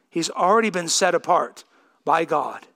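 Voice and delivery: ordinary delivery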